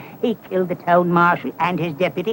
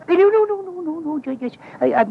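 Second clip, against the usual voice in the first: high, panicky string of denials; flustered backtracking; 10 semitones higher than usual. Acted. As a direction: stammer your way hastily out of trouble. Backtracking before Kid's friends.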